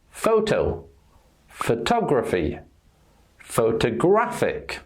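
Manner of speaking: "Photo" on PHO, "photography" on TOG, "photographic" on GRAPH; moving syllable stress. Shown as a demonstration. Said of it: "the stressed syllable is moving".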